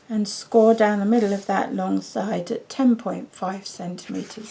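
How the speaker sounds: usual speaking voice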